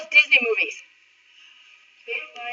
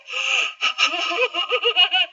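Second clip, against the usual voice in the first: nervous giggle; 5 semitones higher than usual. Acted. Nervous goat laughs.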